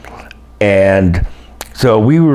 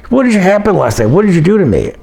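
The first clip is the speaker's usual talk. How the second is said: higher-pitched mock accusation; lightly voiced woman demanding answers, higher; accusing friend; 9 semitones higher, faster than usual. Sharna on the phone blaming Barry, higher mock accusation. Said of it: "and she calls".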